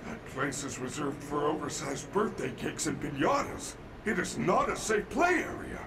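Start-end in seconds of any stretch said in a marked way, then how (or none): 4.1-5.8 loud, higher warning; robot scolding. Acted. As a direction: scold the child firmly.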